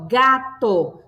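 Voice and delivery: slow "gato", split in two; teacher's slow syllable demo. Shown as a demonstration. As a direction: sound out each syllable of the word. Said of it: "Nós temos duas sílabas".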